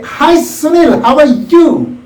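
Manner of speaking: lively melodic greeting. Shown as a demonstration. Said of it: "That intonation and melody".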